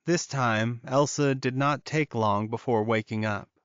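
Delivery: plain reading voice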